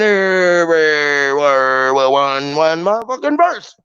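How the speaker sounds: slow smeared words, sinking low then rising; tongue held. Shown as a demonstration. Showing how to hold your tongue and keep saying it, words slurred long. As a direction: talk as if holding your tongue, stretching every word. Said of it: "hold your tongue and you keep saying".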